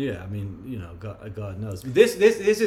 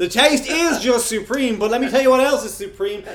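ad pitch; 8 semitones higher, 5 dB louder than usual. Acted. Mock ice cream ad pitch.

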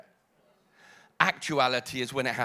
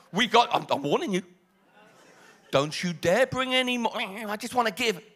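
higher, louder, hammed-up stern warning; mock stern Moses; 3 semitones higher, 5 dB louder than usual. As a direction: scold the givers in playful mock outrage. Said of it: "He stands in front of them and he says".

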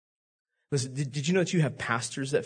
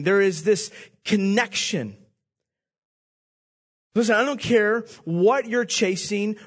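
higher and louder, driving the sermon home; full-force preaching; 6 semitones higher, 4 dB louder than usual. Acted. Pastor drives the point home to the congregation, preaching hard. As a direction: preach it with fire and urgency.

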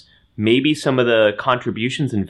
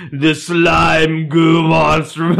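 slow, loud, gooey monster shout; blob monster voice; 5 semitones higher, 4 dB louder, much slower than usual. Acted. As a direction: bellow it like a slime blob. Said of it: "I'm trying to do an impression of the blob voice".

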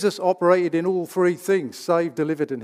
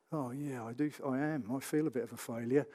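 defeated inner voice; 4 semitones lower, 14 dB quieter than usual. Retold or imitated. Voicing a defeated believer agreeing.

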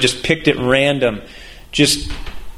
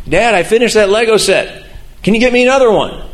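a hint of an eager kid asking dad; lightly voiced little boy; 7 semitones higher than usual. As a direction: pitch it up a bit like a small son. Son Noah asks for Lego.

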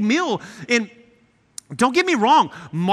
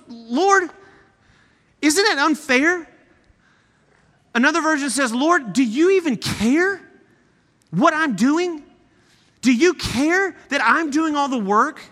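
a touch of exasperated complaint, higher; imitating a frustrated plea; mild snap; 5 semitones higher than usual. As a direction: Martha complaining to Jesus, mildly snappy. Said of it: "she snaps a little bit".

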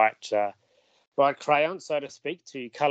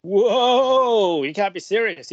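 a high, loud "whoa" of put-on disbelief; hammed-up astonishment, high and loud; playful mock surprise; 9 semitones higher, 6 dB louder, much slower than usual. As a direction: play up the shock for the listeners. Co-host reacts to a teased reveal.

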